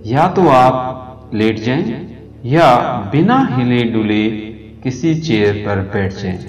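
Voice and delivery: soothing yoga nidra guidance; guided meditation. Acted. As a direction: talk them gently down into rest. Leads a yoga nidra relaxation.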